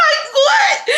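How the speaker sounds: high squeal of laughter into the words; laughing. Real, not acted. Storyteller cracks up at her own bluntness, laughing. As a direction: crack up, then squeak out the question.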